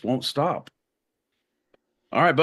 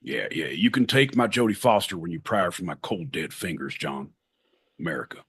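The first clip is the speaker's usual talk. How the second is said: mock Southern accent, low and soft, praising Jodie Foster; 8 semitones lower, 3 dB quieter than usual. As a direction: play a gun-loving Southern good old boy, low and quiet. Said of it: "Southern U S".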